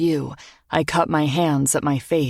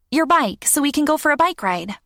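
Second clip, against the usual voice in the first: put-on high, quick eager child; an eager kid asking; young girl voice; 11 semitones higher than usual. Child voice in story.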